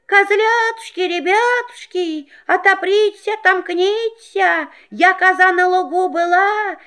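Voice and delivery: wolf singing in a high, thin voice; wolf in disguise. Acted. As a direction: sing it high and sweet as mother. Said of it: "тоненьким голоском запел".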